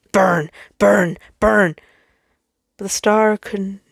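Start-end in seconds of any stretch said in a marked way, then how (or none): 0.1-1.7 loud repeated shout, each word falling; screamed command. A narrator's reading. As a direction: cry it out like a burning instinct. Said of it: "Instinct flared and screamed at him".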